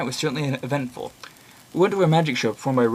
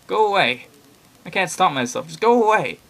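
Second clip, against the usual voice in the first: higher, louder, strained begging; desperate plea; 7 semitones higher, 6 dB louder than usual. Acted. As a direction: push him away, terrified of yourself. Killer voiced in story.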